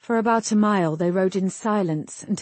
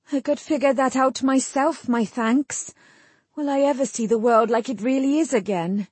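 higher-pitched voice of a dazed woman; dazed, testy Jill; 6 semitones higher than usual. A narrator's reading. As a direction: play her high, a touch testy. Narrator voices Jill in dialogue.

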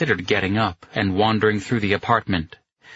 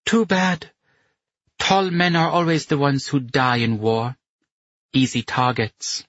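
put-on higher voice, heavy German accent; 6 semitones higher than usual. A narrator's reading. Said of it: "with a heavy German accent".

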